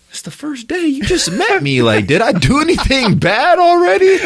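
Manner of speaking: very high-pitched bewildered pleading; incredulous protest. Retold or imitated. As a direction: shoot your pitch way up, baffled at the coach. Retold bewildered protest to coach.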